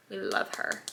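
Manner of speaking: soft breathy whisper; whispered aside. Real, not acted. Aside while applying powder.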